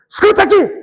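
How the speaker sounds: sudden high, commanding cry; thunderous voice. Retold or imitated. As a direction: boom it out like God's voice. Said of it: "Falou assim com a voz grande, como um trovão".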